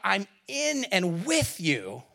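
slow tender. Acted.

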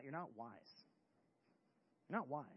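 low, soft, falling delivery; quiet, sobering punchline; hushed verdict. Real, not acted. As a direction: drop low and let it land. Verdict repeated low after build-up.

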